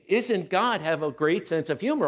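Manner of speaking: ordinary talk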